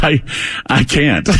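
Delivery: normal talking voice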